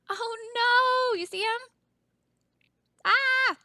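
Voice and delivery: put-on high alarmed cries for the kids; mock fright. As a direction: play scared to thrill the class. Pretend fright at shark.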